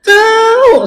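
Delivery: very high, stretched mock reply; clueless "dunno". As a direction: mimic a friend who has no idea. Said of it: "Dia bilang". (menirukan teman yang tidak tahu, nada tinggi)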